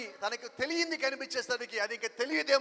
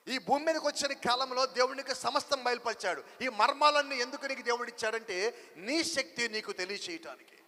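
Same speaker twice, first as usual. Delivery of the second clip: ordinary talk, nothing marked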